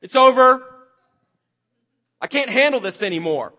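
loud, high mock-despair wail; despairing outburst. Preacher voicing his own despair, loud high wail. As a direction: cry it out like someone at the end of their rope. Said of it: "it's like".

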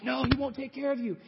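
high, teasing temptress voice; seductive desire. Acted. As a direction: coax him with sly doubt. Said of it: "And she's like".

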